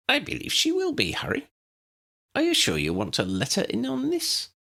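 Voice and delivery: high, squeaky little professor; chirpy, tiny old wizard; Flitwick's voice. Acted. Flitwick answers Harry.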